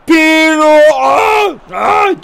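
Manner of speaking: high, loud shouted Aa; excited shout. Real, not acted. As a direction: yell with the big chance. Goal chance, shouting.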